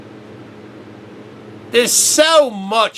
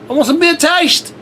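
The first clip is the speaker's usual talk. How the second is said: higher and louder, demanding real beer; excited demand; 9 semitones higher, 5 dB louder than usual. Acted. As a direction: burst out insisting on the beer taste. Ranting about wanting real beer.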